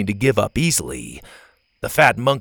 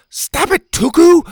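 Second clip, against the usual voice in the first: high, loud stop it; furious loud yell; 9 dB louder, slower than usual. A narrator's reading. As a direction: yell at the monkey while dodging. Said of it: "Bo yelled".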